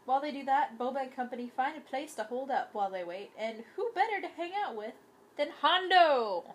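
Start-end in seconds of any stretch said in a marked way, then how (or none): 3.8-6.4 excited. Real, not acted. Excited reveal of favourite character.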